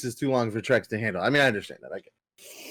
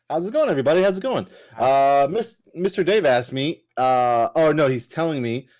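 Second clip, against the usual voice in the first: normal talking voice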